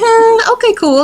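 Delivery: high, drawn-out doubtful hmm, okay, cool; mock sceptical reply. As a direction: play it unconvinced. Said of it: "I was like".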